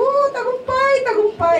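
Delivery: high jubilant cry of victory; triumphant. Retold or imitated. Doctor telling the receptionist, high triumphant cheer. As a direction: crow it out like a winner.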